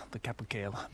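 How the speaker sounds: whispering near the bird; soft unvoiced whisper; muted whisper. Real, not acted. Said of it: "sound a bit muted".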